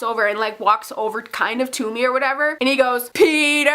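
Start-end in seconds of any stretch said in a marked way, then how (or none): 3.1-3.7 loud, raised, drawn-out name; imitating someone calling out a name; teasing call-out. Danny calling Peter over for the waiting fan, loud call-out.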